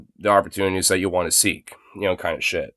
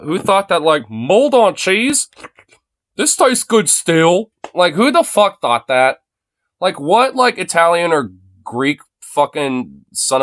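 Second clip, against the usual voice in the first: incredulous rant; 7 semitones higher, slower than usual. Real, not acted. Ranting about blue cheese.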